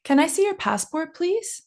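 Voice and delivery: "please?" lifted high at the end; rising question intonation. Shown as a demonstration. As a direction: model question, pitch sweeping up on "please?". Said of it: "your intonation is going up at the end".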